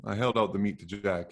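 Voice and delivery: usual speaking voice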